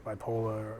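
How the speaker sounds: "bipolar" in a Boston accent, ending "bipola" with no r; Boston accent slip, "bipolar" with the final r dropped. Real, not acted. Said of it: "my Boston accent came out".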